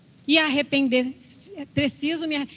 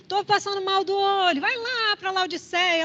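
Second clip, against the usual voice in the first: higher-pitched, stretched-out advice; raised pitch, lightly acted everyday chatter; urging advice; 5 semitones higher than usual. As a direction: play a local sending someone off for a remedy. Acted advice to sufferer of eye trouble.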